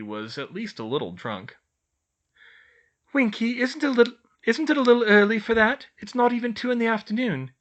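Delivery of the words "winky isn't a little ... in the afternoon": fast worried. A narrator's reading. Hermione fretting, rushing.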